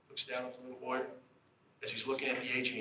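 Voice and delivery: ordinary delivery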